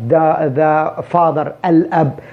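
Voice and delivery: the said slowly with a voiced th; slow careful the. Shown as a demonstration. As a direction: drag out the before father. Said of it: "مثل ما نقول the door الباب". (نطق the ببطء مع ذال واضحة)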